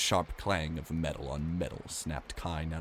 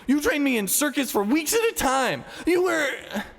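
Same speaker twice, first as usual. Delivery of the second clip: very high, loud furious tirade; a girl's raging outcry; raging accusation; 10 dB louder than usual. Acted. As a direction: scream the betrayal at him. Ani raging at Ivan.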